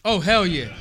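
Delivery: normal talking voice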